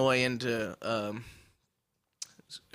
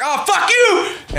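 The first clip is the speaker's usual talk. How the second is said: much higher, louder, slow, laughing yell; slow laughing road-rage shout at a driver; yelled fuck you; 12 dB louder, much slower than usual. Retold or imitated. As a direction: blast it back at the pointing driver. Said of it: "I'm like".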